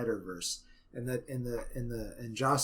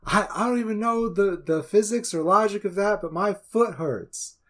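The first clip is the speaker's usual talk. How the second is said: loud, higher mock complaint; put-on Cyborg line, pitched up and exaggerated; 8 semitones higher, 6 dB louder than usual. Podcaster mocking Cyborg's reshoot line, loud exaggerated complaint. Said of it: "and Cyborg's like".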